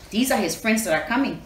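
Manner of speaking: ordinary delivery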